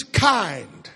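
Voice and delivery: ordinary delivery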